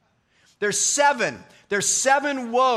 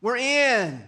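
higher, louder mock-offended protest; indignant Pharisees; 6 semitones higher, 4 dB louder, slower than usual. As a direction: play a smug insider who can't believe it. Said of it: "they're shocked".

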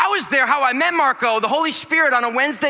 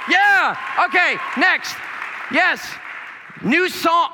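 preaching at full force; 4 semitones higher than usual. Acted. Fired-up preaching.

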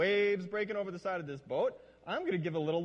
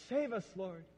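swooning plea; 5 semitones higher than usual. Shown as a demonstration. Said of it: "like the swooning sort of thing".

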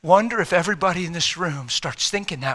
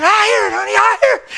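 high, loud and overwhelmed; amazed mother; 7 dB louder than usual. Retold or imitated. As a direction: answer like a mother stunned to tears. Mother answering amazed daughter.

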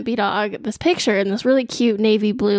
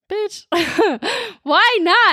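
laughing disbelief; 9 semitones higher, slower than usual. Retold or imitated. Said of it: "I was like".

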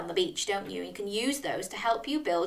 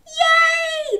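high-pitched celebratory yay; big cheer; 5 dB louder than usual. Acted. Storyteller celebrates the arrival, high cheer. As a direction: squeal out a cheer.

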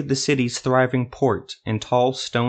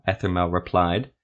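ordinary delivery

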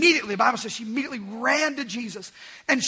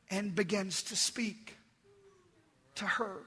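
hushed, tender; 2 semitones lower, 11 dB quieter than usual. Real, not acted. Preacher telling how grace speaks to the woman, hushed. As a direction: drop right down to a gentle hush.

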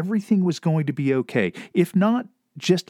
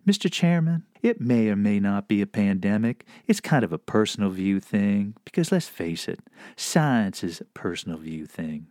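affable southern voice; 3 semitones lower than usual. Acted. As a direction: address the chairman in an amiable Southern accent. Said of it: "at least a little shred of affability".

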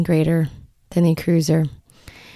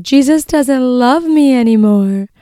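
put-on higher, louder, slow little girl's voice, hurt; imitating her little girl; 6 semitones higher, 5 dB louder, slower than usual. Toddler telling mom Jesus stopped loving her, higher small voice. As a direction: voice the two-year-old's heartbroken words, slowly. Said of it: "she said".

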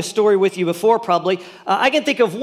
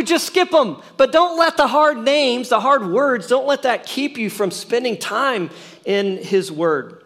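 ordinary talk, nothing marked

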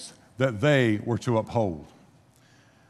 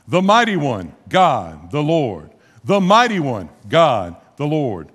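louder solemn declaration, slowing at the end; emphatic proclamation; 5 dB louder, slower than usual. Retold or imitated. Tribes solemnly swearing before God, loud and emphatic. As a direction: proclaim the name with weight. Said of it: "They said it twice for emphasis".

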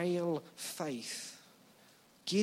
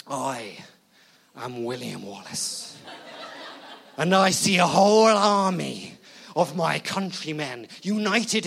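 William Wallace battle cry in a shaky attempt at Scottish; badly faked Scottish; 7 dB louder than usual. Wallace to his army of countrymen, hammed-up Scottish battle speech. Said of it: "too Scottish? Or not Scottish enough".

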